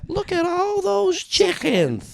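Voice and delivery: put-on very high, loud, drawn-out kid squeal; over-the-top little-kid voice; child impression from a Vine. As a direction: shriek it slowly like an amazed child. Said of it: "I can do children very well".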